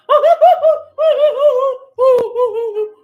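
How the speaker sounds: shrill, loud mock cackle; mocking high cackle. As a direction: ham up her laugh, high and loud. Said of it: "this would be Dee Dee right now".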